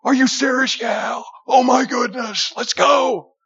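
high-pitched, thrilled outburst; excited. Acted. Friend thrilled at an offer, excited. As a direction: blurt it out, thrilled to get going. Excited acted reply in a story.

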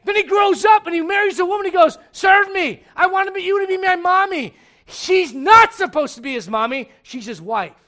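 shouted, high, fiery sermon delivery; full-force preaching. Acted. Pastor shouting a rebuke to the congregation. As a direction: pastor thundering at the congregation about lazy sons.

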